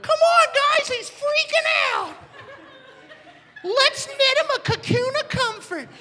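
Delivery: tiny squeaky caterpillar voice, extremely high. Retold or imitated. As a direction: squeak encouragement like a little bug. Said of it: "they're like".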